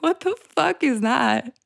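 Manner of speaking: high, slow incredulous question; mock disbelief. Hearing her own Southern accent slip out, slow baffled disbelief. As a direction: sound baffled at your own voice.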